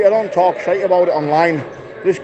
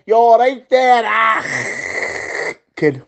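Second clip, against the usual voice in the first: put-on Scouse accent pitched up; mock Scouse; 6 semitones higher, much slower than usual. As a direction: imitate how the Scousers talk. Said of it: "They go".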